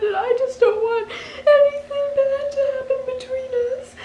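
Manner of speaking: high, tearful pleading apology; drunk crying apology. Retold or imitated. Pleading with a friend who hates her, tearfully. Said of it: "I just kept going on and on".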